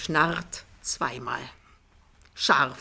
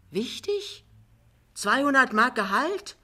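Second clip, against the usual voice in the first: high, incredulous scoff at the salary; mock surprise; 7 semitones higher, 5 dB louder than usual. Jachmann scoffing at Pinneberg's tiny salary, high and incredulous. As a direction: pitch it up in disbelief, then louder.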